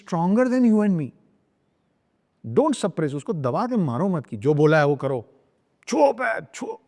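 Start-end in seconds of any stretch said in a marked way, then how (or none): 4.4-6.8 stern parental order. Acted. Parent forcing a child to touch feet, sternly commanding. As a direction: act out a bossy parent ordering obedience.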